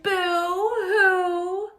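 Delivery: hammed-up boo-hoo, high; little girls wailing to Miss Clavell, hammed up; mock crying. A narrator's reading. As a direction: pretend wail like little girls. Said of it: "all the little girls cried".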